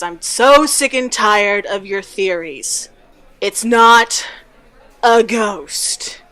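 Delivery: loud, emphatic exasperation; slow, punched words of someone sick of an argument; fed up. Acted. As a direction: argue it down loudly, word by word.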